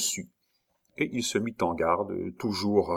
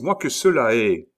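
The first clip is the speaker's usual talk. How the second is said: usual speaking voice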